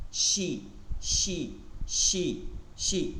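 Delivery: শি with a clear sh; English sh. Shown as a demonstration. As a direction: say শি like English she. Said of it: "ইংলিশে যেটা আমরা S H". (English she এর মতো শি)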